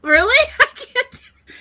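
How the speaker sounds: very high, loud, dissolving into laughter; giddy disbelief. Retold or imitated. She hears it must stay secret, laughing disbelief. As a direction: squeal it and crack up. Acts her reaction, laughing.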